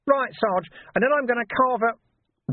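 Nobby boasting to his sergeant; cheeky urchin Nobby. Acted. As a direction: rattle on like a pleased little rogue. Nobby chirps on.

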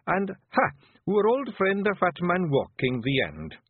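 broad Scots, slow and careful, describing shapes; an unlettered chief proudly sounding out the chalk; Scots chief puzzling out letters. Acted. Rob reads letters as pictures.

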